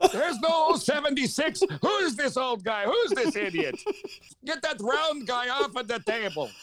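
put-on high, indignant old-timer voice; cranky old bingo player. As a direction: grumble like an outraged senior.

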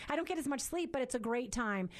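ordinary talk, nothing marked

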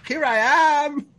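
high, slow, grand heroic announcement; mock heroic Zorro. Governors dressed as Zorro arriving, hammed-up heroic boast. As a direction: draw it out like a proud masked hero. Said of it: "And they're all".

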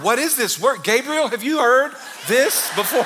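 God pretending shock; mock astonished God. God asking Gabriel about the word, in mock astonishment.

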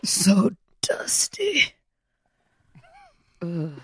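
slow breathy whisper. Real, not acted. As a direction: whisper it, drawn out. Dry crumbly cookie, whispered reaction.

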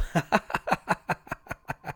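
unvoiced creepy chuckle; villain's hushed laugh; eerie breathy laugh. A narrator's reading. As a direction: let out a sinister breathy laugh. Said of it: "sa skikkelsen".

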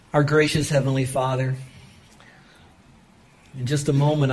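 hushed pastoral prayer; earnest prayer. Acted. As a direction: pray over the room gently. Leads the closing prayer.

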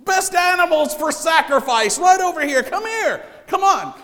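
high, loud carnival barker pitch; chirpy trade show pitch. Retold or imitated. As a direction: hawk it like a fairground barker. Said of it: "he's like".

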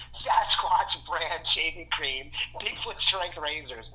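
slow, drawn-out label reading; mock commercial announcer reading the labels. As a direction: read each word like a product ad. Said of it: "it says".

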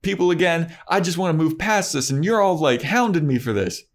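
put-on a defensive teen whining high and fast at his friend; whiny defensive Xander. Buffy asks about Willow, Xander answers in a high fast whine.

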